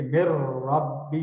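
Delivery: مر ربہ very slow, n merged into the r with no nasal hum; recited merged. Shown as a demonstration. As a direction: draw out مر ربہ with a clean rolled r. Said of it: "را کی مثال آپ دیکھ لیجئے". (مر ربہ بغیر غنہ کے آہستہ)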